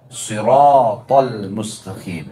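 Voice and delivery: slow "siratal mustaqim" with a heavy sad; Arabic sad. Shown as a demonstration. Teacher to congregation, demonstrating a slow, heavy sad. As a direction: draw out the sad slowly. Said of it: "Beda antara sad".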